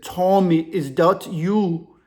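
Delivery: put-on slow, drawn-out African-accented question; imitated African accent. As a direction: play the African caller, each word drawn out. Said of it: "The African dude's whispering".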